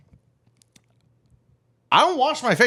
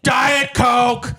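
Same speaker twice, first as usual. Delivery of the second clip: loud, high mock customer shout; hammed-up rude customer call; brusque drink order; 9 semitones higher, 6 dB louder than usual. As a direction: holler the drink order over the server. Imitating customer barking drink order.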